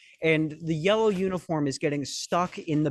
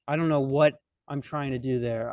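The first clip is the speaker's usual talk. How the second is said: ordinary talk, nothing marked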